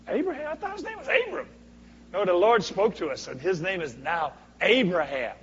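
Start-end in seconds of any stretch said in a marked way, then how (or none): 0.1-1.5 high, confused mock gossip; puzzled neighbors. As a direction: raise the voice as a baffled neighbor. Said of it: "I wonder what the folks did".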